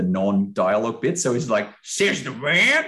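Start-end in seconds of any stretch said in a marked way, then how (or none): 1.8-2.8 higher, drawn-out mock character voice; stuck in character. Host mocking a narrator who couldn't pull out in time, stuck in character. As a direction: drag the narrator's line out in the character's voice. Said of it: "still in the voice of the character".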